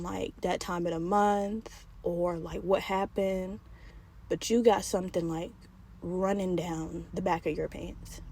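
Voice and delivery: low, soft and slow, with long uneasy pauses; awkward, hesitant. Retold or imitated. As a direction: imitate a man delicately breaking bad news. Man awkwardly breaks embarrassing news.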